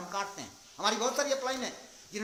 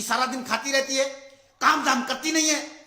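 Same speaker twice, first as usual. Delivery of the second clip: loud, angry yelling from outside; shouting; 5 semitones higher, 8 dB louder than usual. Retold or imitated. Husband shouting at his wife from outside, angry yelling. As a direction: yell a scolding, rising higher. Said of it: "बाहर से चिल्लाने लगा".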